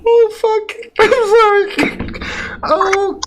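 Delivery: very high, squealing through laughter; helpless laughter. Real, not acted. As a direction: try to apologise while cracking up. Helpless laughter after the impression.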